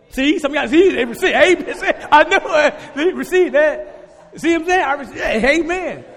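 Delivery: loud, high, laughing praise shouts; preaching at full force. Acted. Pastor shouting joyfully to the congregation about debts zeroed out. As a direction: whip the church up with joy, high and loud. Pastor erupts in celebrating debt freedom.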